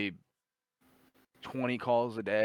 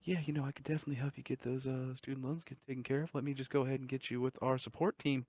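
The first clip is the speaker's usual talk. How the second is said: higher, fast, softer agent patter; quiet, helpful call-center guy, higher pitched; nice phone agent; 5 semitones higher, 5 dB quieter than usual. Retold or imitated. Scammer offering loan help to the caller, nice phone agent voice. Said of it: "guy in a quiet fucking room".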